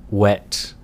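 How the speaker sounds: usual speaking voice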